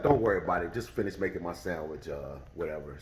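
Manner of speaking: low, soft, unbothered brush-off; cool dismissal. Retold or imitated. The teller tells the cashier to keep making his sandwich, unbothered. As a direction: shrug it off, barely voiced. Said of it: "And I was like".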